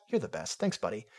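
usual speaking voice

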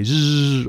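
drawn-out buzzing zzz; bee buzz. A narrator's reading. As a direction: imitate bees zooming past. Imitating the bees flying by.

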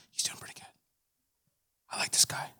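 whispered aside to a neighbor. Retold or imitated. Congregant whispering to neighbor during sermon, hushed. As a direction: lean over and whisper it.

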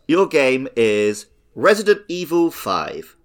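ordinary talk, nothing marked

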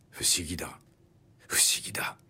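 breathy whispered wonder under the breath; inner whisper. A narrator's reading. Guard wondering silently about the prisoner, whispered. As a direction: murmur it to himself, voiceless and puzzled. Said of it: "と心の中で繰り返している".

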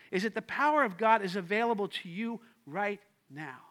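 normal talking voice

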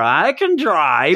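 slow, slurred mock drunk; drunk insisting. Guy leaving the bar at two, drunk insisting. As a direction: drag out the words like a drinker leaving a bar. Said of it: "two A M coming out of the bar".

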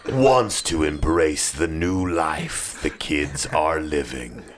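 very low, slow movie trailer announcer; deep, portentous trailer narration with dramatic pauses; RoboCop trailer voice. Acted. Host narrates his own mock action trailer, deep slow announcer voice. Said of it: "like, a RoboCop".

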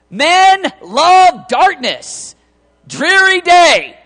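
mock gloomy pronouncement. Mock gloom before correcting himself.

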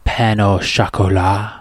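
"pain au chocolat" said the French way, pan-oh-shokola, slow; mock French accent. Barista leaning into French for a customer, mock accent. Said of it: "You gotta just lean into it".

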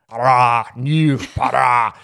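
loud, slow mock Russian-Scottish accent; hammed-up submarine captain, Russian by way of Edinburgh; Sean Connery. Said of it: "Sean Connery as a Russian submarine captain".

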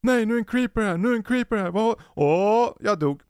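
put-on high child voice yelping about a creeper; imitating panicked kids. Kids yelling as a creeper appears, high mock panic. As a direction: play the kids panicking in the game. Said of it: "det enda jag hör är ju hur de skriker".

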